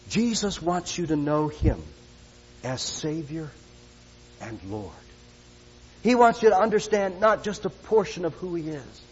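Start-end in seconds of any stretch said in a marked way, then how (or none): none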